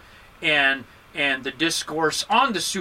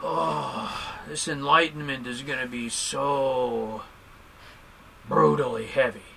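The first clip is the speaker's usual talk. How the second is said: slow, softer, lower, weighed-down moaning; mopey groan; 5 semitones lower, 5 dB quieter, slower than usual. Retold or imitated. Teacher mocking students who mope about enlightenment, slow gloomy moan. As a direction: sigh it out like the practice is a burden. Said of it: "You can't end suffering by going".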